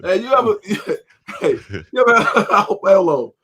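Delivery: can't get the words out for laughing. Real, not acted. Cohost to the others, laughing too hard to finish. Cohost cracking up mid-story.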